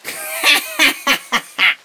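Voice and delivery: very high squeaky creature laugh; mocking high laugh. A narrator's reading. Lightning bolt laughing at the girl's name, very high. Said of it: "ruft der kleine Blitz".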